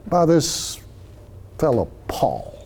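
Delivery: a sneer at this fellow Paul; dismissive. Shown as a demonstration. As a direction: brush him off as a nobody. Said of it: "It's dismissive in tone".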